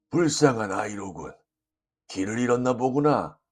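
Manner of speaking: put-on low, gentle elderly man's voice; kindly old gentleman. A narrator's reading. Old gentleman to a lost crying boy, kindly. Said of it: "한 인자한 노신사가".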